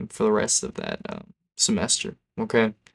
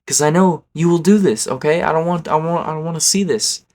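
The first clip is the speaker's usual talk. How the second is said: higher-pitched, insistent objection; exasperated protest; 5 semitones higher, 4 dB louder than usual. Retold or imitated. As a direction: plead your refusal, voice raised in pitch. Protesting the friend's stunt.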